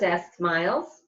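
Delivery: ordinary delivery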